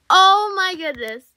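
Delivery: high, loud excited outburst; real excited yell, high-pitched; loud excitement. Real, not acted. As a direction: burst out loudly in amazement. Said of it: "I'm so sorry if I'm loud".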